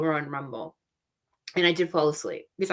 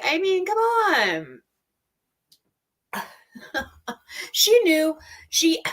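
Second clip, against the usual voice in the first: incredulous; 9 semitones higher, slower than usual. Real, not acted. Disbelief at her excuses.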